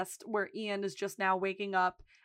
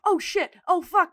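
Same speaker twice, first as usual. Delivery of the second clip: loud, high-pitched retold alarm; mock panic; 9 semitones higher, 10 dB louder than usual. Lip caught with roommate's girlfriend, mock panicked. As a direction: blurt it out as if just caught.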